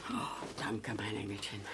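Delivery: tender thanks. Acted. Grandma thanks the helper softly, almost whispering. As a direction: breathe it gratefully.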